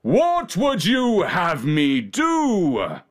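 slow, swooping royal indignation; outraged king. A narrator's reading. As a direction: draw it out in pompous disbelief. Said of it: "The king was outraged".

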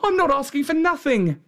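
high, protesting country lad; lad's voice, pitched well up; shepherd boy. A narrator's reading. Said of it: "said the boy".

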